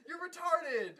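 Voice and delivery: loud, high comic tirade; hammed-up furious yelling, opening on a drawn-out NO; outraged shouting. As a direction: shout down the nonsense in exasperation. Shouts back at the show's character.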